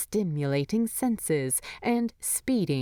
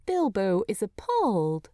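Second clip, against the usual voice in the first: usual speaking voice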